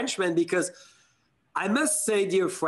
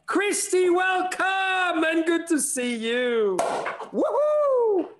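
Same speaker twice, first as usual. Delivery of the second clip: excited welcome; 13 semitones higher, slower than usual. Real, not acted. Host cheering his guest onto the show.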